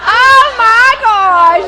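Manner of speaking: loud, high, drawn-out exclamation; stretched-out shriek of surprise; real shocked outburst. Real, not acted. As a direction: cry out in laughing disbelief. Startled by the number called, laughter.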